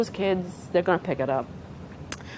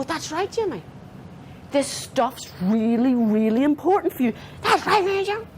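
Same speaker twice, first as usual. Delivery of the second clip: high, intense validating voice; emphatic, high-pitched affirmation; matching their energy; 7 semitones higher than usual. Shown as a demonstration. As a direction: hold the energy up to meet an upset kid. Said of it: "And so I match my voice".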